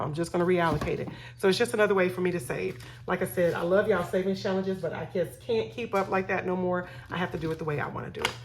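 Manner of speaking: ordinary talk, nothing marked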